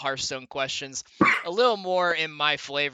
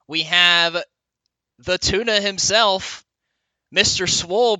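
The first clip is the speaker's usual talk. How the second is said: ordinary talk, nothing marked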